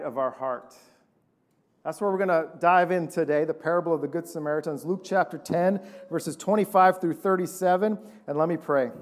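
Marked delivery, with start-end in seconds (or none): none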